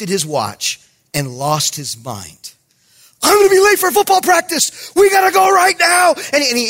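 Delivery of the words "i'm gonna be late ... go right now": high, loud imitation of panic; panicking kid. Kid to his pastor on seeing the time, acted panic. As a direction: cry out as the kid losing his mind. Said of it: "he's, he's panicking".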